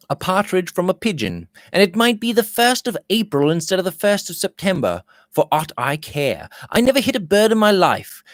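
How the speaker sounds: Robert's voice. A narrator's reading. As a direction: lift the voice for cheerful Robert.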